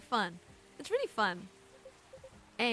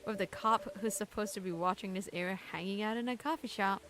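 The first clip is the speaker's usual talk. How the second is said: ordinary delivery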